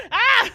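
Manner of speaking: sharp startled cry; frightened shout. Real, not acted. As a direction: yelp in fright. Second jolt of fright.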